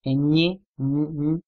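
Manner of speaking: Ñ said with a palatal ny sound; ñ as the ny in canyon. Shown as a demonstration. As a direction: press the tongue to the palate for ñ. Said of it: "with this sound".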